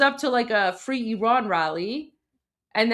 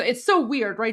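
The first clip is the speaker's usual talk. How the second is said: ordinary talk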